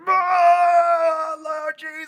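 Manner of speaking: high, loud cry of praise; crying out in worship. Retold or imitated. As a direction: cry out to the Lord. Friend worshipping at the front.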